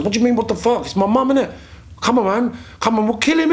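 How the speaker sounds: high, pressing call to his mates; urgent rallying cry. Retold or imitated. A boy urging his friends to defend mum, high and urgent. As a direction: rouse them to go fight.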